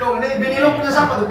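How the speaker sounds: fast, joking jab at a friend; teasing question. Retold or imitated. Preacher teases a listener about his wife, fast and joking. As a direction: rattle it off quickly as a teasing jab.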